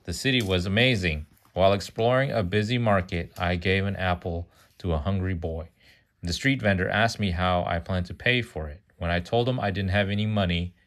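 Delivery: ordinary delivery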